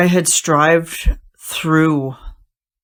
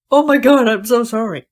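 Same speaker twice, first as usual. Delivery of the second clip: high, louder gush of guilt; retold guilty apology; 7 semitones higher, 5 dB louder than usual. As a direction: retell a distressed apology, pitched up. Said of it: "I felt so bad".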